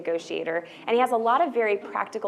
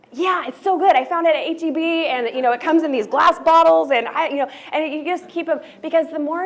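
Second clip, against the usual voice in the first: higher and louder, gushing about the mango water; excited friend; 8 semitones higher, 6 dB louder than usual. Shown as a demonstration. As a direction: rave about it like a thrilled friend. Acted friend raving about water.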